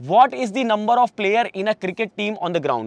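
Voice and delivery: reads the question out very slowly, word by word; slow dictation reading. Acted. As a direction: spell it out slowly for the class. (बहुत धीरे-धीरे प्रश्न पढ़ना)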